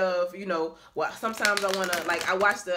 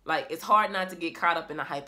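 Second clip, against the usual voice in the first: ordinary talk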